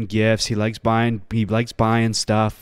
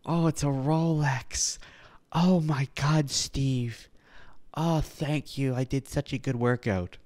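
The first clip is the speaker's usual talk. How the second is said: soft, slow, breathless gushing, lightly mimicked; mock awestruck recipient; 7 dB quieter than usual. Host mocks the clip guy thanking Steve, hushed gushing. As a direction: mimic the stunned gift receiver, hushed and slow.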